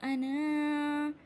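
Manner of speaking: long-held vowels; recitation demo. Shown as a demonstration. Teacher showing the cut alif, slow recitation. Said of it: "ये इस पे cut लगा है".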